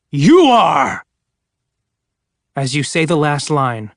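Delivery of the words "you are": much louder jump-scare shout; sudden holler. Acted. Storyteller grabs a friend on the last line, loud holler. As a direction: bark it out to startle. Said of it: "the voice hollered".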